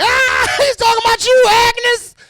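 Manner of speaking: high shrieked laughing tease; laughing old ladies. Acted. Old lady teases her friend at bingo, shrieking laughter. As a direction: cackle high and call out to a friend. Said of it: "They laugh harder than you guys did".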